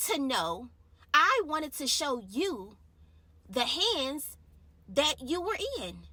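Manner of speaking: voice of God. Retold or imitated. Voicing God's words, building up.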